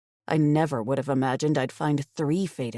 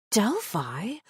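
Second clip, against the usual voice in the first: gasped question; 6 semitones higher than usual. A narrator's reading. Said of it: "I gasped".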